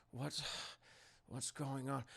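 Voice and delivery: very soft, low retold murmur; hushed suspicion. Captor peers into the vent, acting hushed suspicion. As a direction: peer in and wonder under your breath.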